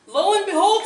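high, loud piglet squeal. Acted. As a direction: squeal it out in alarm. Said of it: "squealed two".

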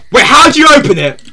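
high-pitched, urgent question; alarmed question. Real, not acted. Player urgently needing it open, alarmed. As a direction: demand it in rising alarm. Asks again in alarm, louder.

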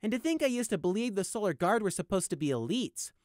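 high, detached icy voice; cold. Acted. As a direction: answer with cold contempt. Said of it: "Prey responded coldly".

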